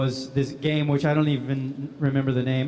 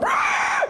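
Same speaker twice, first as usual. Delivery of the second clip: very high goblin shriek; short piercing mock death scream; burning goblin scream; 3 dB louder than usual. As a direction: screech like a goblin on fire. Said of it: "now you're on fire".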